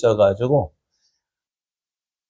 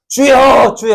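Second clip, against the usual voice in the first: loud prayer cry; 10 semitones higher, 4 dB louder, slower than usual. Acted. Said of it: "해봐요".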